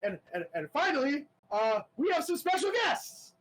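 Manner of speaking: a jittery host forcing big cheer; nervous announcer. Acted. Announcer nervously introducing special guests, high and jittery. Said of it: "And he goes".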